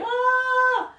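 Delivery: high, short mock scream of "Ah"; a playful imitated shriek; mock kid scream. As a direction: squeal it like excited kids. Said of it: "screaming at me going".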